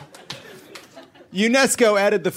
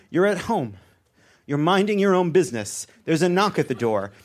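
ordinary delivery

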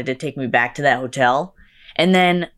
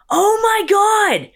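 put-on gushing drunk fan; 12 semitones higher than usual. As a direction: squeal like a tipsy superfan spotting her idol. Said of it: "comes up to us and goes".

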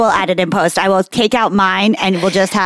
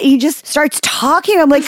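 ordinary talk

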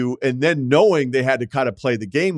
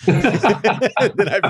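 laughing; 9 semitones higher, much slower than usual. Real, not acted. Laughs while setting up quote.

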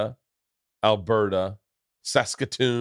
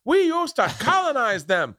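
mock posh Brit; 13 semitones higher than usual. Mocking voice in joke.

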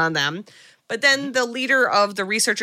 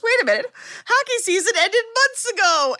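much higher mock-puzzled killer; 13 semitones higher than usual. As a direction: ham up a baffled slasher, high. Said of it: "And the guy goes".

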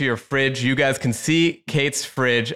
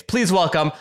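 big rising guest introduction, voice jumping high; announcer intro; 6 semitones higher than usual. Acted. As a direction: build it like a game-show welcome.

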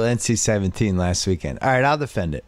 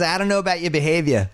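higher-pitched Boston accent, R dropped in "behavior"; fake Boston accent; 6 semitones higher, faster than usual. Shown as a demonstration. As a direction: show how easy Boston is to fake. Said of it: "gonna fake your Boston accent".

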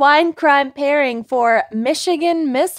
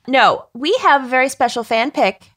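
ordinary delivery

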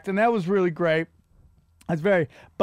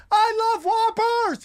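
mock yell; 13 semitones higher, slower than usual. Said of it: "going to Burger King yelling".

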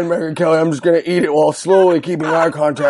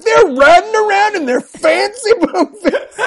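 mocking high voice; 13 semitones higher than usual. Mocking Rand Paul quote.

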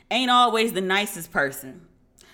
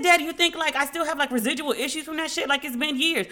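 protesting to dad; 7 semitones higher than usual. Retold or imitated. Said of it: "I said".

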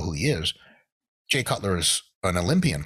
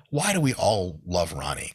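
usual speaking voice

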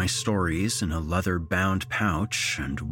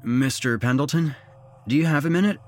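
higher-pitched, tentative request; sheepish; 7 semitones higher, 4 dB louder, faster than usual. A narrator's reading. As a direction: ask sheepishly, pitch lifting at the end. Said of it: "I asked in a sheepish voice".

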